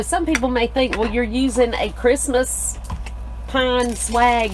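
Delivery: ordinary talk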